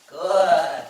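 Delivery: loud, stretched-out praise word; big approving praise; hearty praise. Real, not acted. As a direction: reward the dog with your voice. Praising the dog.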